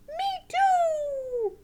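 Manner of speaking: high, loud drawn-out squeal; squeaky puppet shout; excited puppet Sally. Pretend. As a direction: chime in as a hyper puppet.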